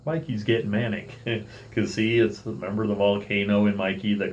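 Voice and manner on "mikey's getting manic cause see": usual speaking voice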